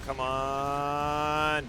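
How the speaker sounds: ordinary talk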